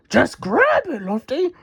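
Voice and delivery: high cat voice calling out; a hint of a cat calling for help; voiced Pilchard shouting. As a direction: call up to Lofty in a high voice. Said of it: "shouted Pilchard".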